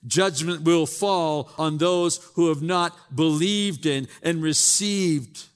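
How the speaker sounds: fervent pulpit preaching; full-force preaching. Acted. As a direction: proclaim it to the whole church.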